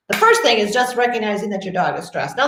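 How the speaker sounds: ordinary talk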